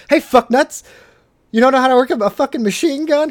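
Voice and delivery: hammed-up angry soldier; mock berating. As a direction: chew out the cadets, loud and high. Acting Green Berets berating cadets.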